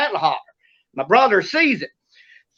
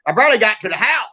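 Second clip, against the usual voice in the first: ordinary talk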